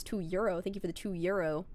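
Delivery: ordinary talk, nothing marked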